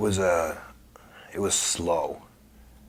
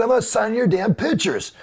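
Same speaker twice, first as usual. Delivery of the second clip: much higher, louder indignant reply; 13 semitones higher, 4 dB louder than usual. Retold or imitated. He snaps back at the caller on the phone, high indignant retort. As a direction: push back hard on him.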